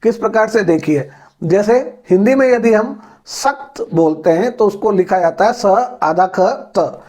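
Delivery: ordinary talk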